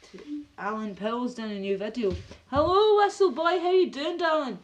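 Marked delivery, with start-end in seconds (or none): 2.5-4.6 big high-pitched welcome; excited greeting. Real, not acted. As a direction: holler a delighted greeting to a friend. Hailing a viewer who arrives.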